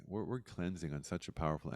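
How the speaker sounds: ordinary delivery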